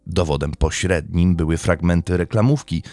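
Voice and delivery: steady, measured storytelling read; crime podcast narration. Acted. Host recounting a murder trial to listeners, in a steady narrative read. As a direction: lay out the court case like a documentary narrator.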